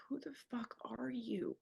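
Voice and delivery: slow, breathless whisper of disbelief; stunned whisper. Retold or imitated. Recalling her partner scolding her on air, a slow shocked whisper. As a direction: hush it out like you can't believe it. Retold stunned inner reaction.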